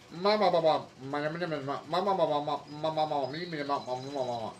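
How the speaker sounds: a hint of a mocking protester, babbling; mocking gibberish. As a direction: babble it like nonsense. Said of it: "I really don't even understand what she was saying".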